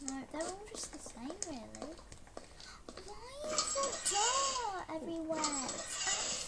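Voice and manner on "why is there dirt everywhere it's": high slow whiny complaint; disgusted whine. Real, not acted. Disgusted at dirty room.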